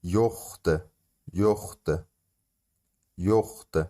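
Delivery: یخت said three times, slow and clear; repeated Turkish word. Shown as a demonstration. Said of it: "یعنی نیست". (یخت سه بار شمرده)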